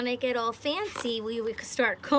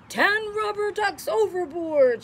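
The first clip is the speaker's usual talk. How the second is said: loud, high alarmed call; shouting captain; 9 semitones higher, 6 dB louder than usual. Acted. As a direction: bellow it like a ship's captain. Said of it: "shouts the captain".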